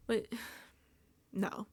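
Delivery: normal talking voice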